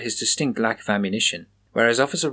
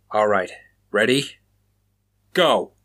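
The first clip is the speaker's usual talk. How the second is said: a mild holler, louder on the go; 3 dB louder than usual. A narrator's reading. Raymond signalling Chaplin to start running, hollering go. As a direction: shout the start signal across the yard. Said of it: "Officer Raymond hollered".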